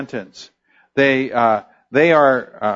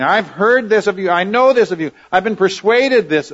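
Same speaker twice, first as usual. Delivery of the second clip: raised, earnest apostle's assurance; higher and louder, pressing the point home; voicing the apostle; 7 semitones higher, 4 dB louder than usual. Preacher voicing the apostle's earnest assurance.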